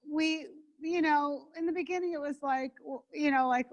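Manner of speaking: usual speaking voice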